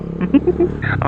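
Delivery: a soft airy chuckle at the memory; breathy laugh. Acted. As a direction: laugh under your breath, amused. Husband laughs at bike memory.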